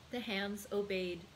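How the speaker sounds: plain reading voice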